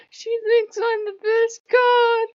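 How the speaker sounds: very high, loud singsong mock glee; gloating Odin impression. Flattered god derailing the fight, hammed-up squealing pride. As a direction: stretch it out, preening and delighted.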